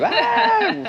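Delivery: high mock shriek; girly scream. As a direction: squeal like a little girl. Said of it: "screaming like a little girl".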